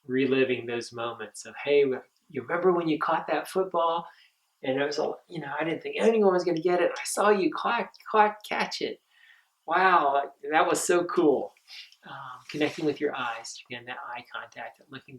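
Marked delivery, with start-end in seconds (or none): 1.6-11.5 high, lively recalled praise; excited praise. Retold or imitated. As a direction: gush over the kid's great catch. Counselor reliving moment with camper.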